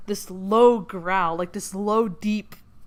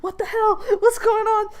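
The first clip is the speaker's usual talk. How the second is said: mock crying panic; 12 semitones higher, 6 dB louder than usual. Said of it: "like, crying".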